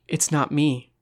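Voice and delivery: plain reading voice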